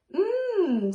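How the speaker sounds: high, loud savouring hum; yummy mmm. A narrator's reading. Dragon eating the gloop happily, savouring hum. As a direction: relish the taste. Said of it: "chuckled with glee".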